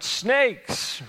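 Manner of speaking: high-pitched retold yell of alarm; mock scream, pitched well up; imitated scream. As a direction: call it out high, mimicking a scream. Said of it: "just screams out".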